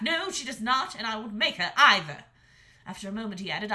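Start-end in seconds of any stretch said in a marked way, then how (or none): 0.0-2.3 loud yell back. A narrator's reading. Young dragon yelling back at his mother.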